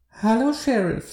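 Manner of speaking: put-on high, excited childlike voice; squeaky like an excited little kid; unpleasantly high voice. A narrator's reading. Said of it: "in einer unangenehm hohen Stimme".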